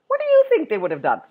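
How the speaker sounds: ordinary delivery, nothing marked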